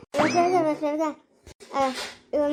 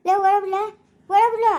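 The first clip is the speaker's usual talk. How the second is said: louder furious yell, crying; angry shout; 5 dB louder than usual. Acted. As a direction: shout to be let go after her. Furious at the babysitter. (ಕೋಪದಿಂದ ಕೂಗು)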